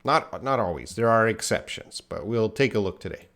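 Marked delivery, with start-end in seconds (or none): none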